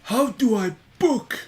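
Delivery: high, loud, drawn-out mock idiot voice; clueless non-reader. Co-host mocking non-readers, high clueless voice. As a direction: play someone baffled by a book.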